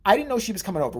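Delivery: ordinary talk, nothing marked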